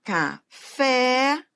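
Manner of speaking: Café split in two, FÉ held long; stress on last syllable. Shown as a demonstration. Teacher showing an oxítona, FÉ stretched in Café. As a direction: separate the syllables of Café, lean on FÉ. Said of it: "é a última sílaba da palavra".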